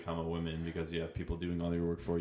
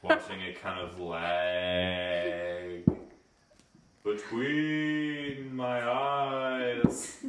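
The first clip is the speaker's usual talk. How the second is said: words stretched out very slowly, rising into a sung tone; 5 semitones higher, much slower than usual. Real, not acted. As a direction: tipsily draw each word out into a long croon. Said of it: "Yeah, I'm drunk".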